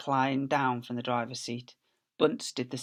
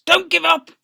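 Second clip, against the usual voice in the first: high, loud, breathless plea; panting encouragement; 11 dB louder than usual. Acted. Mister Fox panting to his family as they dig. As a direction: gasp it out to the family. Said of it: "panted Mister Fox".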